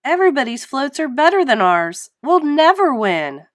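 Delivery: high, loud despairing wail, slowing; crying out. A narrator's reading. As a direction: wail in defeat. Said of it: "cried SpongeBob".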